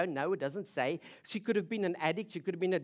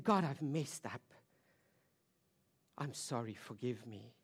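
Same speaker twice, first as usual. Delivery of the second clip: low, soft confession; acted hushed repentance; penitent prayer; 8 semitones lower, 6 dB quieter than usual. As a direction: sink into a quiet apology. Voices the woman's repentant prayer.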